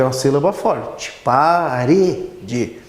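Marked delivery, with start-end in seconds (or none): 1.2-2.8 "Parede" separado em sílabas, pa-re-de; ênfase no RE de Parede. Shown as a demonstration. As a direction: destaque o "re" de "Parede", sílaba por sílaba. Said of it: "é uma sílaba tônica". ("Parede" escandido, com o "re" forte)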